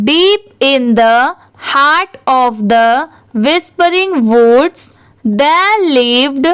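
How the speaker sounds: very slow, word by word, pausing at each word; slow reading. Shown as a demonstration. Teacher reads aloud slowly for students to practise. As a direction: read each word slowly for the class to follow. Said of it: "आपके लिए मैं ये सारे words को थोड़ा धीरे धीरे पढ़ूंगी".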